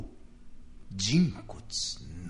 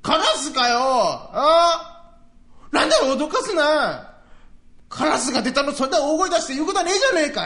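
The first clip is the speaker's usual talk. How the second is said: loud, high, fast scolding; indignant outburst; 12 semitones higher, 7 dB louder than usual. Acted. Neighbor scolds the old man for shouting. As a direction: snap back at him for the fright. Neighbor snaps back, relieved.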